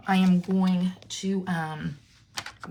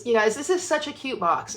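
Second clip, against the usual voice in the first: ordinary talk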